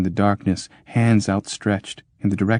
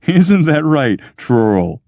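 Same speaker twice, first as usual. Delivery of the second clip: slow coaxing chuckle; soothing laugh; 7 semitones higher, 5 dB louder, slower than usual. Acted. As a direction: laugh it off to calm him. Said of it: "said the mediator, soothingly".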